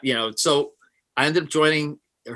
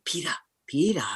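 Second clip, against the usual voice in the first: lightly voiced mom, higher and urgent; a hint of mom waking dad at night; mock-urgent mom whispering to her husband; caricature of mom; 6 semitones higher, 3 dB quieter than usual. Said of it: "it's a caricature".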